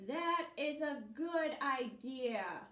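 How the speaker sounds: loud slow bear. Acted. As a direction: answer like a big lumbering bear. Bear agrees.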